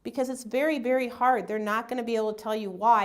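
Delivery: usual speaking voice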